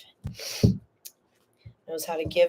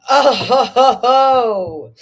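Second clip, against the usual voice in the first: loud, higher, thrilled reveal; loud and delighted, pitched up; delighted exclamation; 4 semitones higher, 8 dB louder, much slower than usual. Real, not acted. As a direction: exclaim it big, then draw out the oh-ho-hos. Delighted reaction to a viewer's card.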